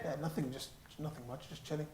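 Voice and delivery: put-on dismissive teenager mumbling; sulky teen brushing off a parent.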